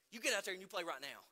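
fast, loud stern order; stern dad order. Retold or imitated. Father ordering his son back onto the court, fast and stern. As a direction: snap the order at the crying kid. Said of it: "I said".

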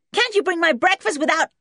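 put-on loud, high-pitched bratty boy; cross complaint. A narrator's reading. Spoilt boy scolding whoever brought breakfast, loudly cross. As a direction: scold shrilly until cut short.

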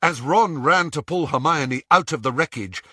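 plain reading voice

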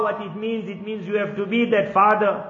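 usual speaking voice

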